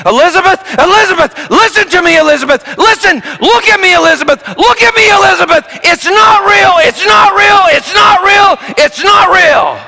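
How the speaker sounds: high, loud urgent shouting to reach her; desperate screaming. Retold or imitated. Father screams to his terrified daughter over the ride. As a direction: yell it over the noise to calm your child. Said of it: "I started screaming".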